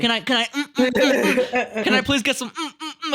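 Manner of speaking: high, giggling, wheedling hums; mock begging boyfriend. Mocking the boy begging Chad Chad for a reward, high giggly pleading. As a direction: beg like a needy puppy through laughter.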